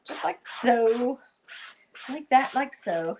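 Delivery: a hint of a showy TV presenter, slow and emphatic; TV catchphrase voice. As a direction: lightly mimic a TV personality savoring the line. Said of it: "That's how they say it".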